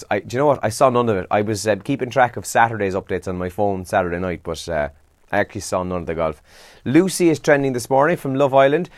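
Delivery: ordinary talk, nothing marked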